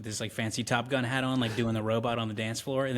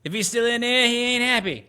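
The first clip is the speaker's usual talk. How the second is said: higher, louder mock tough guy; hammed-up marine quote; 11 semitones higher, 7 dB louder than usual. Friend quoting Major Payne after shooting the closet, loud mock tough guy. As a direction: bark it out like a proud marine.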